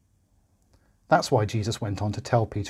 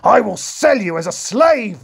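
high, loud, slow furious threat; roaring king; 10 semitones higher, 6 dB louder than usual. A narrator's reading. The king threatening to sell the servant, roaring. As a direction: roar the sentence down at him. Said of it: "roared the king".

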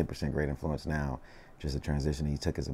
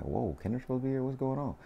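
retold surprise, pitched higher; 9 semitones higher, 3 dB quieter than usual. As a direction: react as if caught off guard, voice up. Retelling his surprise at who arrives.

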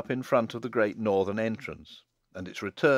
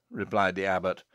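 plain reading voice

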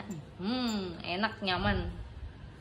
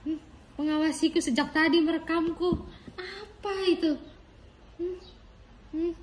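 put-on baby's suspicious inner voice; 9 semitones higher than usual. Baby's thoughts about the camera.

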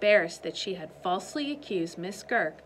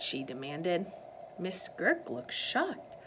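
ordinary delivery

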